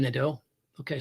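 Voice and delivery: normal talking voice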